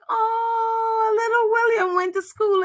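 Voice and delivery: high, doting coo; gushing aww. Retold or imitated. Reacting to a little boy's show and tell, high doting coo. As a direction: coo over the little boy, pitched high. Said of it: "And it was just like".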